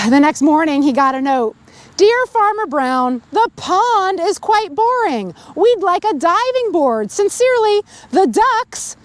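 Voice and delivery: put-on lively read-aloud to children; storybook reading. A narrator's reading. Reader telling children the farm story, lively read-aloud. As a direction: tell it like a bedtime story. Reads picture book to kids.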